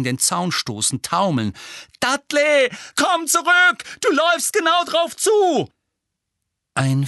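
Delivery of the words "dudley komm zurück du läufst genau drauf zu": high, loud warning yell; urgent shout. A narrator's reading. Harry shouts a warning after the fleeing Dudley. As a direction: shout to stop him running into danger.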